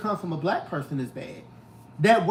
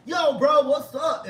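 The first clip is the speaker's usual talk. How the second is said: street slang greeting; 7 semitones higher, slower than usual. Retold or imitated. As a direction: toss out a casual street greeting. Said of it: "if I spoke like".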